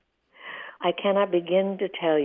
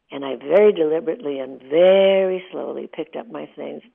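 ordinary talk, nothing marked

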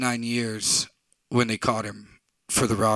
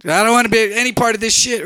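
higher and louder; exasperated outburst; 11 semitones higher, 10 dB louder than usual. Retold or imitated. Narrator refusing to join the argument, exasperated. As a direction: throw up your hands.

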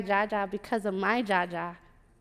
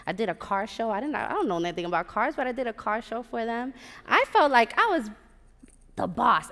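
usual speaking voice

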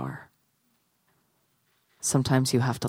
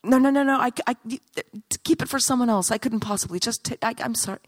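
flustered polite refusal; 9 semitones higher, faster than usual. Acted. Girl refusing the statue's flower, flustered apologetic refusal. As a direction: decline in a fluster, pitched up and tailing off.

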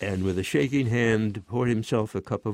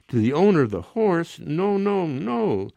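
higher-pitched, mildly indignant echo; incredulous objection; 8 semitones higher than usual. A narrator's reading. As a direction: throw the answer back in disbelief. Incredulous rebuttal in voiced dialogue.

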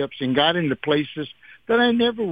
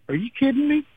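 much higher, astonished outburst; surprised; 11 semitones higher, faster than usual. Retold or imitated. Engineer [name] hearing [name] name [name], stunned and high. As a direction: blurt it out in disbelief, pitched way up.

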